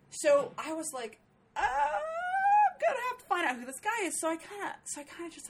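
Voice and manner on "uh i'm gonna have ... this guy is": much higher, squeaky panicked voice. Retold or imitated. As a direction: squeak it out in mock alarm. Retold panicked inner thought.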